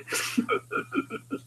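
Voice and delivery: high airless giggle; breathless laugh. Real, not acted. Reader laughing breathlessly at the scene. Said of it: "Oh, boy".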